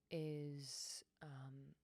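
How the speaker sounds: whispered trailing off. Real, not acted. Teacher whispering mid-thought. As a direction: drop to a soft whisper.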